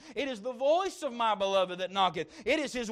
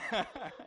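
short laugh; 2 semitones lower, 9 dB quieter than usual. Real, not acted. Laughs after joke.

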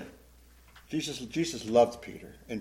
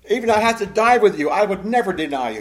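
loud, high-pitched protest; speaking up; 7 semitones higher, 10 dB louder than usual. Acted. Peter swearing loyalty to his Lord, speaking up loudly. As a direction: speak up forcefully in your own defence. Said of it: "Peter speaks up".

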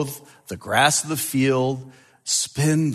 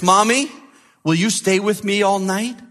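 put-on trembling child; 7 semitones higher than usual. A narrator's reading. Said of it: "asked in a trembling voice".

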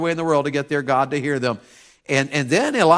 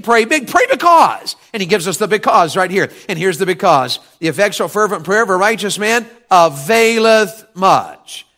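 loud, high pulpit delivery; fired-up preaching; 5 semitones higher, 6 dB louder than usual. Real, not acted. Preacher drives a verse home to the church, fired-up. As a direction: preach it out with force.

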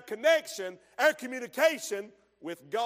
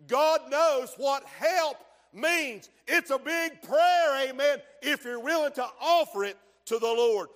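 preaching at full force; 3 semitones higher, 5 dB louder than usual. Acted. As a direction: hammer each word out. Preacher shouts, slowing down.

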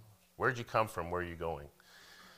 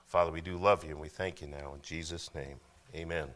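congregational prayer. Acted. Pastor leads congregational prayer.

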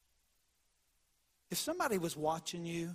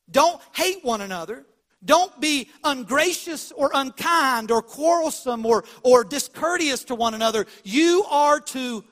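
loud, raised, drawn-out preaching; forceful command; 5 semitones higher, 11 dB louder than usual. Retold or imitated. Preacher quotes Jesus commanding love, loud and forceful. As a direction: press each command home slowly and forcefully. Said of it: "just gently yet forcefully says".

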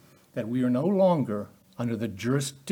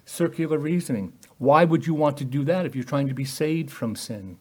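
usual speaking voice

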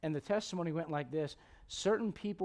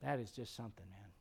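hushed, much softer voice of wonder; hushed Adam in awe; 8 semitones lower, 14 dB quieter than usual. Retold or imitated. Pastor voicing Adam admiring the horse, hushed awe. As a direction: breathe it like a marveling first man. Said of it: "Adam's like".

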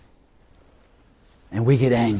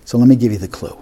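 normal talking voice

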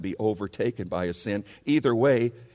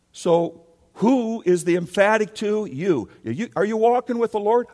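higher, louder pulpit voice; preaching at full force; 8 semitones higher, 3 dB louder than usual. Acted. Pastor urging the whole church, fervent preaching. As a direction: preach it out, pressing each point.

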